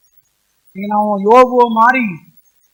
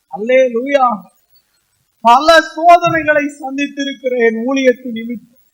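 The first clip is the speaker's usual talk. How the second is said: normal talking voice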